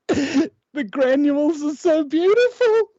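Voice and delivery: high, slow, hammed-up tearful sigh; mock weepy. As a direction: overcome by coffee, comically. Crying while making coffee.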